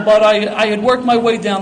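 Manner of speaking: normal talking voice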